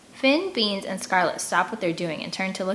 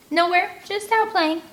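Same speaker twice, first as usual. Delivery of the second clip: much higher, louder voice, too-innocent; nervous cover-up; 11 semitones higher, 5 dB louder than usual. A narrator's reading. Kid hiding their plan from a stranger, nervous high pitch. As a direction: sell the lie a bit too hard.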